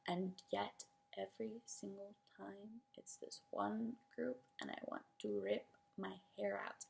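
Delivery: hushed, nearly whispered exasperation; weary disbelief. Real, not acted. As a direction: drop right down, as if worn out by it. Worn down by repeated trope.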